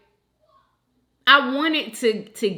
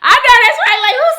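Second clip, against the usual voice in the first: high, loud squeal after laughing; cracking up; 14 semitones higher, 12 dB louder, much faster than usual. Real, not acted. Host reacting to a funny comment, cracking up. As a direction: agree loudly, tickled by the joke.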